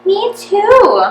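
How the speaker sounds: high, loud mock eager chime; Margaret's eager chime. A narrator's reading. Margaret joining Eric's teasing, high and loud. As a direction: pile on cheerfully.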